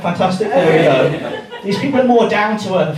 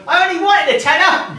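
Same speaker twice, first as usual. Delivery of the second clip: much higher, overjoyed squeal; delighted guest; 11 semitones higher than usual. Retold or imitated. Guest holding the money, mock overjoyed. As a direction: imitate a thrilled punter.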